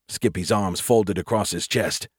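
ordinary delivery, nothing marked

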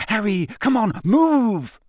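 high, loud and frantic urging; urgent plea. A narrator's reading. Hermione tugging Harry backward by his collar, frantic urging. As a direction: hurry your friend away in alarm.

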